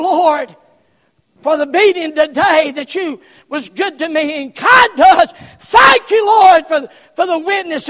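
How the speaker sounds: high, shouted prayer of thanks; praying out loud. Acted. Preacher acting Paul thanking God in prison, loud shouted prayer. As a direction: cry out thanks at full volume. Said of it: "there's Paul up there saying".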